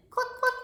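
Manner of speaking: high, short hen clucks; chicken clucking. Acted. Storyteller showing children what a chicken says, high clucking. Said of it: "what's a chicken say?".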